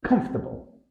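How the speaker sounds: Comfortable as a quick, high COMF-ta-ble; compressed pronunciation. Shown as a demonstration. Teacher repeating the native version, compressed pronunciation. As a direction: let the fort in Comfortable almost vanish. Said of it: "where the fort almost disappears".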